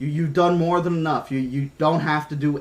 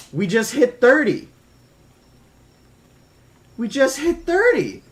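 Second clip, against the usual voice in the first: higher-pitched, amazed announcement; excited disbelief; 7 semitones higher than usual. Real, not acted. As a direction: celebrate the milestone in real amazement. New subscriber milestone on stream.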